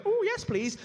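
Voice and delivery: high-pitched, keen yelp; eager acceptance. Retold or imitated. Comedian answers a private party invitation, high eager delight. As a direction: jump at the offer in a high voice.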